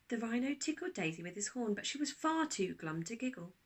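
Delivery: ordinary delivery, nothing marked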